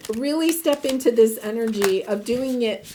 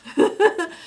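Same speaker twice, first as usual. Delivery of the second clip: a quick bright laugh, pitch jumping up; short laugh; 6 semitones higher, 3 dB louder than usual. Real, not acted. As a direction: laugh lightly at your own words. Laughing at her own quote.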